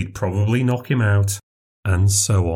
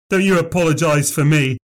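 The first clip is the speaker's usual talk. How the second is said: 1 indignant; 6 semitones higher than usual. A narrator's reading. Drunk rejects landlord's apology.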